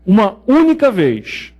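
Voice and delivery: única drawn out slowly, uma única vez; stress on única. Shown as a demonstration. Teacher stressing to students: uma única vez, única slow and firm. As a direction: lean on única, slowly, one time only. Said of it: "e isso tá bem frisado".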